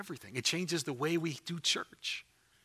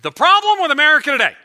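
much higher, louder Perot voice; Ross Perot impression; 16 dB louder than usual. Pastor performs his Ross Perot imitation for laughs. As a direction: announce it like Perot with big ears. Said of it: "let me do my Ross Perot imitation".

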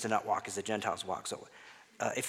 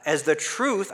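"truth" raised and louder; stress on truth; 4 semitones higher, 5 dB louder, slower than usual. Shown as a demonstration. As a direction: punch "truth" high and firm. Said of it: "emphasize some other words".

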